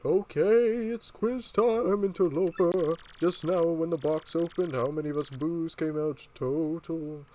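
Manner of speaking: high, drawn-out ghostly quizmaster. Acted. Boo springs a quiz on the intruders, high ghost voice. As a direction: wail it high like a taunting ghost. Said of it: "I'll give him a ghost voice".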